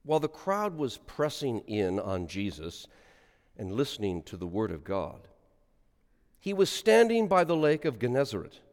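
solemn liturgical reading aloud; gospel reading. Acted. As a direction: intone the gospel for the congregation.

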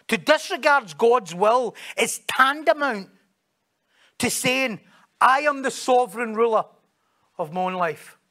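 high, forceful preaching delivery; emphatic quote reading. Real, not acted. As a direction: drive every key word home from the pulpit. Reads a quotation emphatically.

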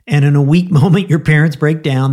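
normal talking voice

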